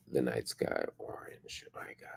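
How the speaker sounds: very slow, fading into a whisper; hushed dismay. Real, not acted. Newsreader reacting to the blaze, hushed dismay. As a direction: let the words drop away, slow and barely audible. Reacts to footage of burning home.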